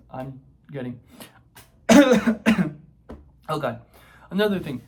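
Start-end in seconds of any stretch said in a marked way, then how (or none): none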